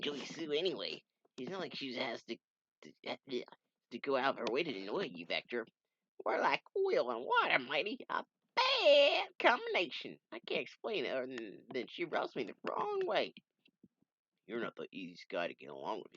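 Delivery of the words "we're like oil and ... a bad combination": high, loud grumbling voice; high character voice. Acted. Comic character voiced by reader.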